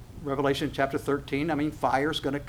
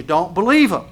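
louder, stern command on believe; firm warning; 3 semitones higher, 9 dB louder than usual. Acted. God warning the congregation sternly and loudly. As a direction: lean hard on believe. Preacher voicing God's warning.

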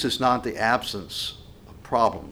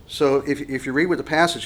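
normal talking voice

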